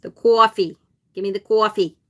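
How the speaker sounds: mock New York City "coffee"; New York City accent. Shown as a demonstration. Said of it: "New York City accent".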